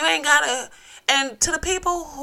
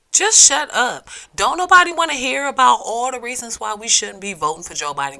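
fed up; 4 semitones higher, 6 dB louder than usual. Real, not acted. Telling complainers to stop.

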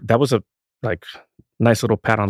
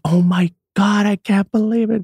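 excited; 11 semitones higher, 4 dB louder than usual. Retold or imitated. Sister reacting to big news.

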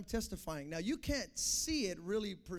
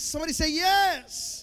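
loud, higher call for a response; rally the crowd; preacher calling for an answer; call to the congregation; 7 semitones higher, 11 dB louder than usual. Acted. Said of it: "call to the crowd".

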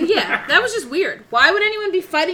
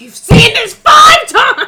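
slow, loud, high-pitched disbelief; mock exasperated shout; 11 semitones higher, 13 dB louder, much slower than usual. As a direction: imitate someone yelling in exasperation, dragged out. Retold exasperated shout at a viewer.

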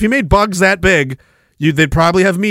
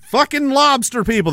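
ordinary talk

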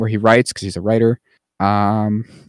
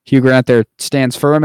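ordinary talk

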